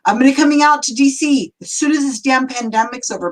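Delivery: thrilled, shouting it out high; excited. Real, not acted. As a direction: burst out with joy. Excited about award trip.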